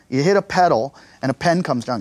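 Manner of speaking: usual speaking voice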